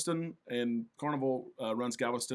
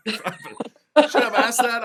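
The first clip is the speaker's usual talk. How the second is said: laughing, higher and louder; 9 semitones higher, 12 dB louder, much slower than usual. Real, not acted. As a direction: crack up at the answer. Laughs at the obvious answer.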